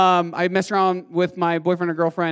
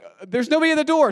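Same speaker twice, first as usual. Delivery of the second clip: high, quick retold stage line; Grant; 9 semitones higher, 3 dB louder, faster than usual. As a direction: rattle the line off in a raised pitch. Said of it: "he'd be like".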